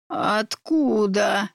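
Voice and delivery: slow, creaky witch voice; old witch voice. A narrator's reading. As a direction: drag it out like a greedy hag. Witch eagerly asks about potion.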